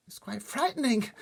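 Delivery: high, loud fearful voice; frightened girl's cry. A narrator's reading. Sophie reacts to the nightmare, frightened cry. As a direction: cry it out, scared. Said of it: "Sophie cried".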